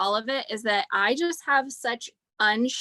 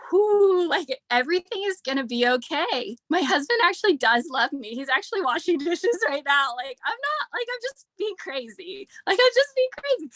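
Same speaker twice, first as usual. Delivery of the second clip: much higher, giddy relieved rush; relieved self-talk; 6 semitones higher than usual. Retold or imitated. Nursing her baby and reassuring herself, giddy and much higher. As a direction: gush it like a weight has lifted. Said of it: "I was just like".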